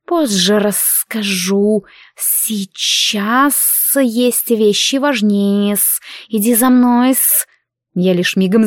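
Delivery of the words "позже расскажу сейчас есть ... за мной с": slow, stretched serpent hiss; hissing serpent dragon. Acted. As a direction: hiss each word like a snake. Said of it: "прошипела Альгиз".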